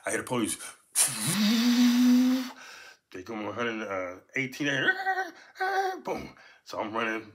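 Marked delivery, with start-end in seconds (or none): 0.9-2.8 squealing high laugh; a real, loud high-pitched laugh; shrill laughing fit; high burst of laughter. Real, not acted. Laughing at memory of police. 4.7-5.3 high laugh. Real, not acted. Laughing again.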